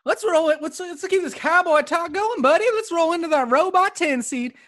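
high-pitched hammed-up cowboy; goofy mock cowpoke announcer; cowboy talk. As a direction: put on a silly high cowboy voice. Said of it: "if you're gonna talk like that".

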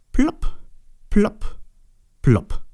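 popping plopps, stepping down from high; imitated plopping. A narrator's reading. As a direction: pop each one out separately, pitch dropping. Eggs dropping from the hare's bottom.

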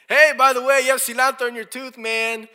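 loud, high mock yell; yelling across. Tactless friend announcing cilantro to the whole circle, yelling. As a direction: holler it across a crowded party for everyone to hear. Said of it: "who in the middle of the circle says".

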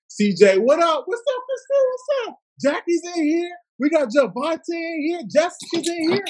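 excited shout-outs. Real, not acted. Hyped welcomes of live viewers.

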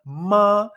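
"ma" high, loud and held level; first tone. Shown as a demonstration. Teacher repeats the example syllable, "ma" sustained high and flat. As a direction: stretch "ma" on a steady high pitch. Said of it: "ma en primer tono".

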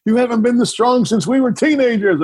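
high, lightly voiced delighted wife; mock swooning wife. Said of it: "He goes".

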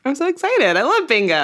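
high squeal of delight; excited. Acted. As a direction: gush about bingo. Mock delight at bingo.

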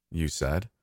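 usual speaking voice

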